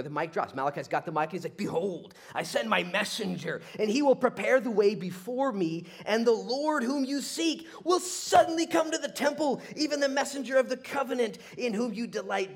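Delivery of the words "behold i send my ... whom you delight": high, loud declamation building in force; prophet's proclamation. Acted.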